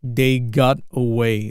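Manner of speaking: slow, careful model of the film line; slow repeat. Shown as a demonstration. Teacher to students, modelling the line slowly. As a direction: spell it out slowly for the class. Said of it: "teacher repeats film line slowly".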